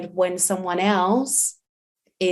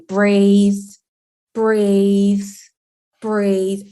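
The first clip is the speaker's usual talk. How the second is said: put-on loud, insistent "breathe" repeated at the child; pushing a crying child to calm down, too loudly; anxious urging; 7 dB louder, much slower than usual. Parent urging a tantrum child, loud and anxious. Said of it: "than say".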